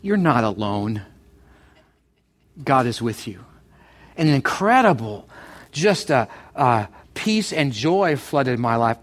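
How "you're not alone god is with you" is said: lower, a hint of calm reassurance; reassuring wife. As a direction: gently voice the wife comforting him. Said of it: "she just said to me back".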